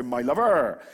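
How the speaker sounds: loud West Country "my lover", drawn out; Bedminster "my lover". Retold or imitated. Preacher mimics Bedminster folk calling everyone "my lover". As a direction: belt out "my lover" like a Bristol local. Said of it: "Or if you're from Bedminster".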